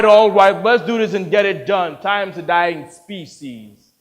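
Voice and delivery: loud, hearty director's shout; loud call. Acted. Director rallying the room to get it done, loud call. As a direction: call out loudly to get things moving.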